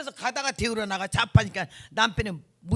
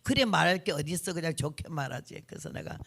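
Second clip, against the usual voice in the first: ordinary talk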